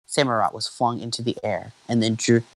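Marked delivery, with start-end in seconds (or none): none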